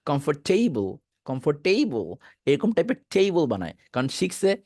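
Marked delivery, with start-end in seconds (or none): 0.0-2.1 কমফোর্টেবল with full "for" and long "tei"; mistaken "comfor-TAY-bol". Retold or imitated. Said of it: "অনেক সময় বলে ফেলে". (কমফোর্টেবল, ফোর আর টেই স্পষ্ট করে)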